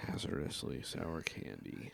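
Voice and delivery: hushed ominous whisper. Acted. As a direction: whisper it like a dark warning. Reading the candy label ominously.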